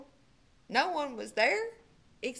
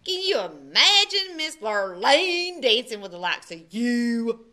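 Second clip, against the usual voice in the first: louder scornful jeer; mocking; 7 dB louder than usual. A narrator's reading. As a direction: scoff at the idea. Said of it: "said Milton".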